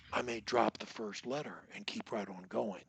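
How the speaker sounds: breathy whisper, no voice; soft whispered crutch demo. Shown as a demonstration. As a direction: whisper it to the table. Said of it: "Or you can say".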